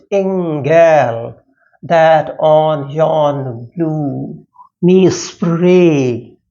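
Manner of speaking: slow, drawn-out verse reading with long vowels; poetry recitation. Acted. Teacher reading Milton's sonnet to students, slow drawn-out recitation. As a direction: recite it slowly, stretching each word. Reads the poem aloud.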